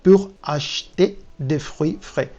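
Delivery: slow, careful French sentence read aloud. Shown as a demonstration. Teacher reading a sample French sentence to learners, slow and careful. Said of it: "vamos a hacer una prueba con el francés".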